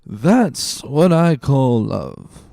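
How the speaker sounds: slow, slurred tipsy voice; drunken slow drawl. Acted. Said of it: "with a voice more tipsy than ever".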